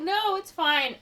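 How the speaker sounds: high put-on protest; mock insistent refusal. She declines an escort to her door, mock insistent refusal. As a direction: wave him off in a high voice.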